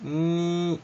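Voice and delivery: "ني" as n and y merged, held; ny sound demo. Shown as a demonstration. Teacher isolating the gn sound for students, held steady. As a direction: sustain "ني" as a single ny. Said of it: "يعني النا واليا".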